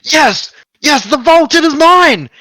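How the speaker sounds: high, loud triumphant yell. A narrator's reading. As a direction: crow it out in greedy triumph. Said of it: "he immediately yells out".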